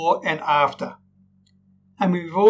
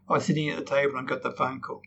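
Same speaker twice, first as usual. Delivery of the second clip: softer, falling, heavy news; bad news, voice sinks; flat and sad; 3 semitones lower, 8 dB quieter, faster than usual. Shown as a demonstration. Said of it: "flat and sad".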